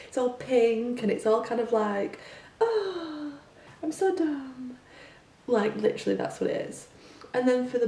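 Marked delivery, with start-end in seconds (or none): 2.6-4.8 put-on very high, airheaded advert girl; mock a ditzy girl, pitched way up. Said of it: "They talk in a really high voice".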